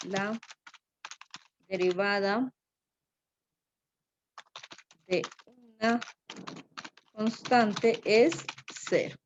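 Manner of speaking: word by word with long pauses; slow, halting pace of someone writing as they speak; slow dictation. Real, not acted. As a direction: spell the sentence out slowly, pausing between words. Dictates rule while writing it.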